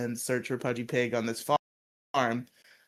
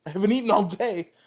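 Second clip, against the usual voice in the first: loud, higher whine; whiny Bulk; 8 semitones higher, 6 dB louder than usual. Retold or imitated. Bulk whining to Zach about his sandwich, loud and high. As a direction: protest like a spoiled kid. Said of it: "Bulk's like".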